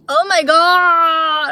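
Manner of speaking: loud, drawn-out cry of dismay; real distressed exclamation; upset outburst. Real, not acted. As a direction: wail it slowly at the broken glasses. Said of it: "I'm so upset".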